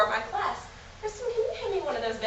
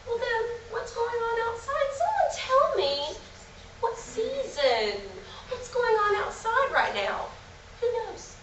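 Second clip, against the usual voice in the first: put-on kids show host; 6 semitones higher, slower than usual. Shown as a demonstration. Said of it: "I'm talking to you once again like the normal age".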